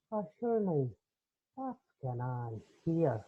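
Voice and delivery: slow, smooth Irish charm; a light Irish accent, drawn out to charm; Irish consultant. Retold or imitated. Handsome Irish consultant arriving, slow easy Irish accent. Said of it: "that's what happens when a woman hears an Irishman's voice".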